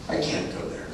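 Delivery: very soft, low, pained; hushed shame. Retold or imitated. Son too ashamed to face his parents, hushed. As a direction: let the voice drop away in shame.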